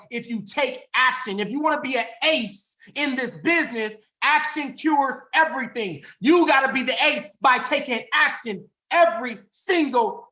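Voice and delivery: high, loud, driving preacher voice; fiery motivational preaching. Acted. Speaker rallies the sales team, fiery high preaching. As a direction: fire up the team from the pulpit.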